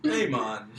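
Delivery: loud mock Jamaican accent. Joking about accents turning Jamaican, mock Jamaican accent. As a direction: toss out a hammed-up Jamaican greeting.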